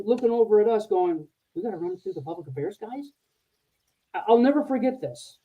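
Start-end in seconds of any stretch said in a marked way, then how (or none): 1.5-3.1 hushed disbelief. Retold or imitated. Staff echoing order in disbelief.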